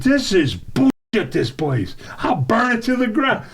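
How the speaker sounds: high, stretched-out angry rant; retold screaming. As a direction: re-enact a customer losing it. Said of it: "I started screaming".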